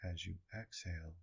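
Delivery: soft, hushed meditation guidance. Acted. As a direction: calmly count the breaths aloud. Guides breath counting softly.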